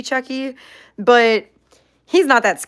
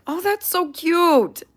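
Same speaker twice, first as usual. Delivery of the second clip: cooing; 9 semitones higher, slower than usual. Real, not acted. Cooing over viewer comment.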